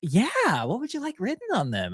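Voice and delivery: high, bright barista voice; chirpy drive-thru worker; drive thru speaker. Retold or imitated.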